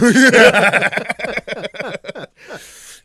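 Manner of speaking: high, loud burst of laughter; real loud laugh. Real, not acted. Friend reacts to the drumstick joke, big real laugh. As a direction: laugh out hard and long.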